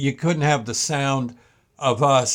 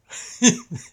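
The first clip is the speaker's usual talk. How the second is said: high laugh; 12 semitones higher, 3 dB louder than usual. Real, not acted. Laughs at memory.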